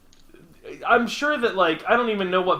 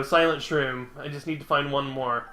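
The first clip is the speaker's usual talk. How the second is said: ordinary talk, nothing marked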